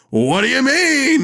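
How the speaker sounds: high, slow, drawn-out clueless whine; Brett playing dumb. Brett answering Larry about his parking, high slow clueless. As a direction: stretch it out high and innocent. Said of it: "And then Brett's like".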